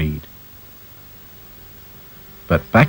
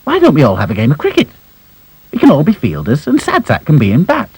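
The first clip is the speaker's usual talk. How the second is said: quick, higher eager voice; excited idea; 7 semitones higher, 3 dB louder, faster than usual. Acted. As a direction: blurt out a bright idea. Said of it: "said Lucy suddenly".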